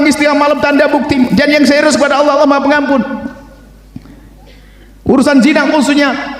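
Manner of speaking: high-pitched, urgent exhortation; forceful preaching. Real, not acted. Preacher urging sinners to tell only Allah, forceful and high-pitched. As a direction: preach insistently, voice held high.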